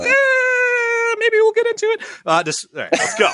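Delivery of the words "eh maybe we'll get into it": high squeaky mock whimper; mock whine. Host whimpers in mock distress before telling the story. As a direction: whine it out in a tiny pained voice.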